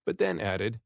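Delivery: ordinary delivery, nothing marked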